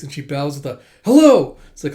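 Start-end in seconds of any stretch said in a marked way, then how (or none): 1.0-1.6 loud, high eager hello; hearty greeting. Retold or imitated. Junior bows to owners of the space, loud eager greeting. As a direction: belt out a formal greeting.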